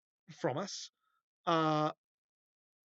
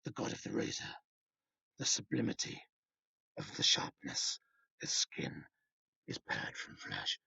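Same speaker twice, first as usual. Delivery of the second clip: low, hushed menacing whisper; mock villain voice; 8 semitones lower, 8 dB quieter than usual. Comic reader mocking villain in a low whisper. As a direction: breathe it out like a cheap horror villain.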